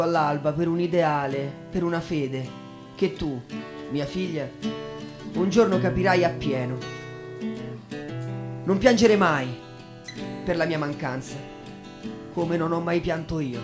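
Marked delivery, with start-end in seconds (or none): none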